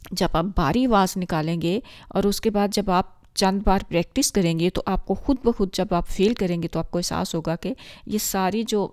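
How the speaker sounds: ordinary talk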